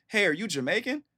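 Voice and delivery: high-pitched, curious mock voice; imitated homegirl. Homegirl asking about his locks, high imitated voice. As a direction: pitch it way up like an eager questioner. Acting out homegirl's naive question.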